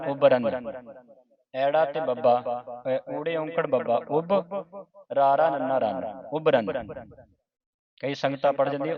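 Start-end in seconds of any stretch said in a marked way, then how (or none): 0.1-0.7 ਉਬਰਨ split as "ub-ran"; light short vowels in ਉਬਰਨ; correct pronunciation. Shown as a demonstration. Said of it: "ਇਸ ਦਾ ਉਚਾਰਨ ਹੈ". 6.3-6.7 ਉਬਰਨ as "ub-ran"; short first syllable, then "ran" in ਉਬਰਨ; correct pronunciation. Shown as a demonstration. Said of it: "ਇਸ ਦਾ ਉਚਾਰਨ ਹੈ".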